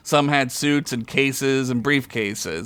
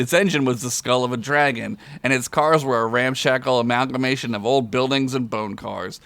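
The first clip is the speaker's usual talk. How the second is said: usual speaking voice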